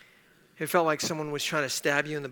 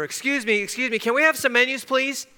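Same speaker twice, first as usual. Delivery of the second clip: raised, higher-pitched plea for attention; calling out; 8 semitones higher, 5 dB louder than usual. Retold or imitated. Ignored teenager trying to get a server's attention, calling out. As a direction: call out politely but insistently to a server. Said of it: "we would call out".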